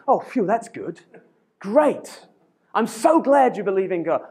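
exaggerated relief rising to high enthusiasm; mock delight. Imagined Elijah welcoming a believer, mock delighted. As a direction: gush with relief, hammed-up.

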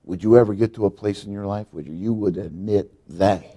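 ordinary delivery

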